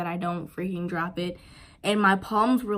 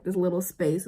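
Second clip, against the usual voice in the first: ordinary talk, nothing marked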